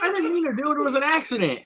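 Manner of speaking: high-pitched acted excuse; hammed-up defensive line; mock protest. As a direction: plead innocence in a high quoting voice. Acting out film dialogue.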